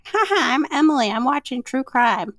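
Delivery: high, taunting dog mimicking its owner; mock your owner in a high put-on voice; dog's mocking voice. Dog in owner's chair making fun of her, high mocking voice. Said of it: "me making her voice".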